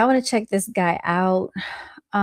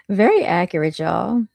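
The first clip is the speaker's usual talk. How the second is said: ordinary delivery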